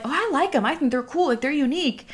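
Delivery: high, light imitating coworkers; high bright voice. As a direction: chirp it up high. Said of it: "everybody was like".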